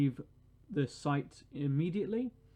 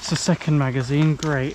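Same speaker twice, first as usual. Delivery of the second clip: sarcastic annoyance; 12 dB louder than usual. Real, not acted. Annoyed player, sarcastic great.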